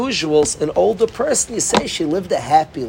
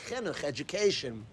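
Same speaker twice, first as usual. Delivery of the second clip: ordinary delivery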